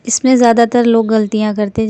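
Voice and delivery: normal talking voice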